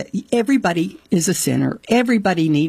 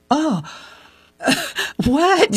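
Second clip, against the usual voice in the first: shocked realisation bursting out; astonished what; 10 semitones higher than usual. Retold or imitated. Astonished reaction retold.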